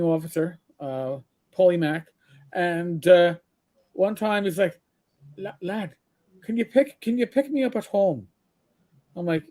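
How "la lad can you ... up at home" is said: imitated captain's Irish brogue; higher-pitched request in an Irish brogue. Captain asking his driver for a lift, in Irish brogue. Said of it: "with a thick Irish brogue".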